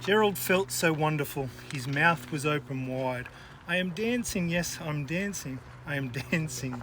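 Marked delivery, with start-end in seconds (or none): none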